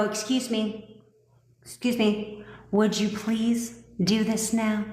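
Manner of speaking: a hammed-up meek plea for permission; meek pleading. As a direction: beg politely like a pushover. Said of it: "queens and kings do not go".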